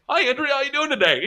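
high, put-on boss greeting; boss on Zoom. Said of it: "her boss is like".

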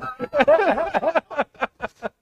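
laughter way up high and loud. Real, not acted. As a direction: cackle at your own joke. Risa tras el chiste. (carcajada muy aguda y fuerte)

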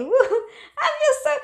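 high, loud whoop with a laugh, slowing down; laughing happy whoop; gleeful whoop. Retold or imitated. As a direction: cheer with a laugh. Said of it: "Man, you just".

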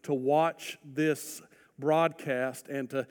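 ordinary talk

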